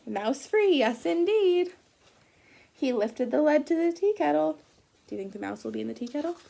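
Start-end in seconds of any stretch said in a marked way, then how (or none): none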